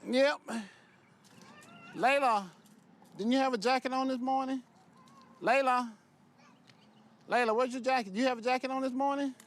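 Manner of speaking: high, sing-song voice for toddlers. Real, not acted. Father talks to toddler daughters.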